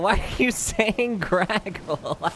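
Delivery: slow words broken up by real laughter; laughing. Real, not acted. Streamer laughing at chat for saying Graggle, real laughter. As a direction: laugh it out in disbelief. Laughing at chat's wrong name.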